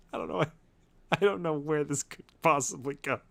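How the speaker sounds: real laughter through the words; cracking up, barely getting it out; laughing. Real, not acted. Reader breaks up laughing.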